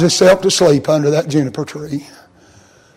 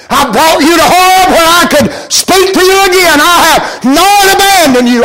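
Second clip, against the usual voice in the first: rising preaching; 9 semitones higher, 3 dB louder than usual. Acted. Voicing God, preaching.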